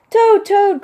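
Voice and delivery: very high, loud double call; crying out. Acted. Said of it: "cried Frog".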